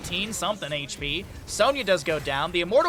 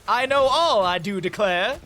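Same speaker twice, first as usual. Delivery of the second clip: hammed-up Southern drawl, louder on the first line; 4 semitones higher, 6 dB louder than usual. Streamer answering chat in a mock Southern drawl. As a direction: ham up a Southern gentleman. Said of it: "why you're Southern all of a sudden".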